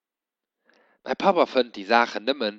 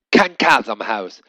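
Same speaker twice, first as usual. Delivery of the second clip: loud, slow, firm father's refusal; father shouting; 7 dB louder than usual. A narrator's reading. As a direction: lay down the law. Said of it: "rief mei Pap".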